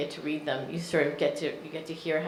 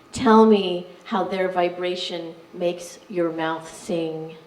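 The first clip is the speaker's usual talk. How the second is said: measured poetry reading with deliberate pauses; poem reading; 5 dB louder, slower than usual. Acted. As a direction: read each line out with weight. Reads poem to audience.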